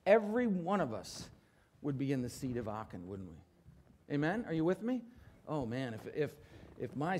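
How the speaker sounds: normal talking voice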